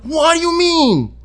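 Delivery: high, drawn-out mock indignation; hammed-up offended shriek; imitated outraged woman. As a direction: stretch it slowly, affronted, way up high. Imitating her offended reaction.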